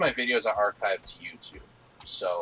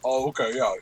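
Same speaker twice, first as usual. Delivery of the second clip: usual speaking voice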